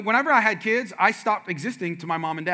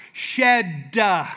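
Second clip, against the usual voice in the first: mocking duh; 4 dB louder than usual. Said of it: "my wife goes".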